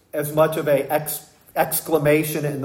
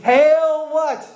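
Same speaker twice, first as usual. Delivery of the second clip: mock hail; 12 semitones higher, 4 dB louder than usual. Soldiers mocking Jesus.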